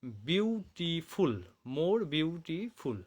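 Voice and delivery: very slow, word by word; slow spelling-out. Shown as a demonstration. Teacher to students showing the form, very slow. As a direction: drag each word out for the class. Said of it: "যদি আমরা বলি".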